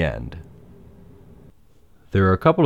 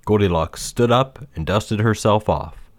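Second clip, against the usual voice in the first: usual speaking voice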